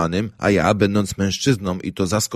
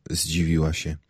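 ordinary delivery

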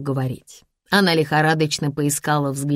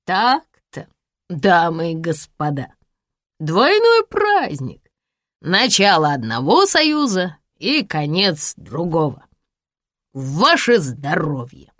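put-on drunk toast; slower than usual. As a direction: raise a bitter drunk toast.

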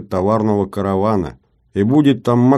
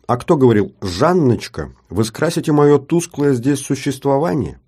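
higher mocking girlish quote; 7 semitones higher, 4 dB louder than usual. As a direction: tease by quoting his flattery back. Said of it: "включилась Жанна". (насмешливо передразнивая)

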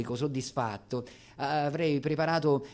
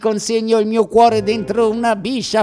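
higher, louder, then speeding through the rhymes; mock-poetic torrent; grand declaiming; 9 semitones higher, 15 dB louder than usual. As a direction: build a swell then babble the rhymes. Tongue-twisting rhyming verses.

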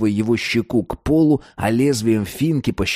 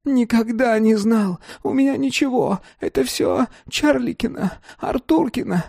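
high, whimpering helpless babble; babbling dealer; 12 semitones higher than usual. A narrator's reading. Defeated dealer babbles excuses, whimpering. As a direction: ramble weakly in a squeaky voice. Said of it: "неся какую-то чушь".

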